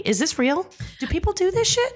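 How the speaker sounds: rising disbelief, pitch climbing high; can't believe people do this; incredulous high-pitched disbelief. Real, not acted. Incredulous outrage at strangers.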